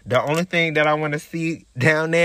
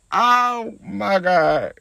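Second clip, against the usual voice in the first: mock wail; 5 semitones higher, 4 dB louder, much slower than usual. Real, not acted. Remembering being wronged.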